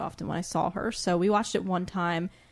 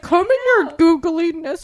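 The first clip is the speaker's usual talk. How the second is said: higher, louder put-on cartoon voice; imitating a trailer line for a friend, higher and louder; cartoon villain voice; 6 semitones higher, 9 dB louder than usual. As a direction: ham up a Hercules villain line. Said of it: "picturing it in my mind".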